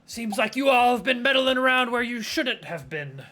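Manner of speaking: loud, high menacing gang leader; loud mobster warning; mob boss threat. Acted. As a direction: loom over them like a mobster. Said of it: "I have lost my Italian accent".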